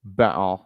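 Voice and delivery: "Baton" as mock-British banter, r dropped; British-style banter. Shown as a demonstration. Streamer showing how Brits say banter, "Baton" in UK accent. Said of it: "That's how they say banter".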